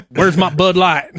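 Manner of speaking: a laughing take on a rude tourist; rude drunk tourist. Retold or imitated. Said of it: "open-mouthed rude to people".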